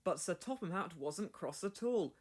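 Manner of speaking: usual speaking voice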